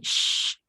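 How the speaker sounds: long unvoiced shh; spreading shin hiss. Shown as a demonstration. Teacher demonstrates tafashi, long spreading sh. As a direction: let the air spray out on sh. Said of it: "Berhemburan bunyi".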